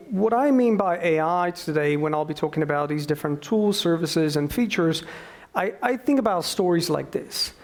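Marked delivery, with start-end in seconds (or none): none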